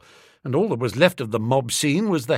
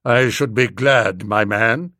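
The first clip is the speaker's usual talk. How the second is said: put-on stern old boy; 5 semitones lower, 4 dB louder than usual. A narrator's reading. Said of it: "old boy lifted the hat off me".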